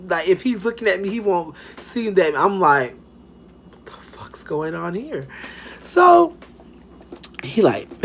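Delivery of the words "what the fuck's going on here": whispered aside to oneself; secretly baffled whisper; hushed disbelief. Retold or imitated. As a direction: say it under your breath, very softly. Private thought during awkward car ride.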